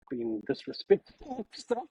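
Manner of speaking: ordinary talk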